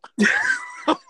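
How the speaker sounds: very high, loud laugh; real squealing laughter. Real, not acted. Listener still cracking up at the prank story, squealing laughter.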